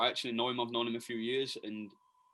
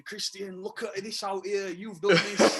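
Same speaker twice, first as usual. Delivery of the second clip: put-on drunk guy; 8 semitones higher, 6 dB louder, slower than usual. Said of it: "that one drunk guy putting his arm around you".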